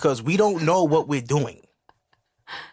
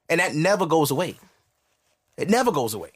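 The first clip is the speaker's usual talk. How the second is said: usual speaking voice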